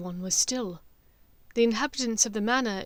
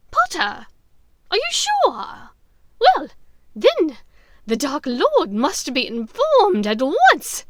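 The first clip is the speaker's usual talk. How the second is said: slow, high, loud witch; eager dark witch, much higher; Bellatrix; 6 semitones higher, 10 dB louder, slower than usual. Acted. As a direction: savour the news, high and slow. Voices Bellatrix.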